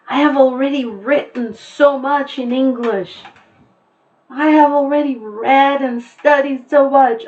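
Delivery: high, loud whiny student voice; imagined complaining student. Retold or imitated. Teacher voicing a student worried about extra work, whiny and high. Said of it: "I didn't want anybody to feel that".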